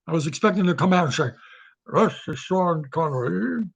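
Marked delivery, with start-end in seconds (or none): 1.9-3.6 put-on slow Scottish Connery accent; Sean Connery impression. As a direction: do a bad Sean Connery, drawn out. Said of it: "worst impression of Sean Connery ever".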